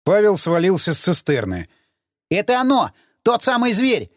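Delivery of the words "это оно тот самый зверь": high, urgent cry of recognition; alarmed exclamation, raised pitch. A narrator's reading. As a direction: sound the alarm at a dreaded sight. Alarmed cry at sighting the beast.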